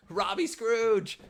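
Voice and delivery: slow, loud, high mock announcement; dramatic title. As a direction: announce it slowly like a grand title. Dubs himself Scrooge dramatically.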